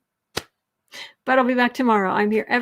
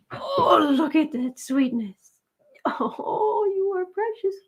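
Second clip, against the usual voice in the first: high, teary pet talk; tearful cooing; 5 semitones higher, slower than usual. Real, not acted. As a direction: gush over the pet, near tears. Emotional cooing over pet.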